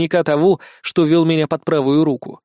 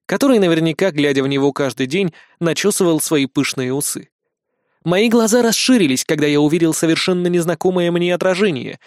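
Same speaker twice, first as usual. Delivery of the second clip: usual speaking voice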